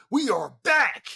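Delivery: high, excited holler; hyped. Real, not acted. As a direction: announce the comeback with a celebratory yell. Celebrating anime season's return.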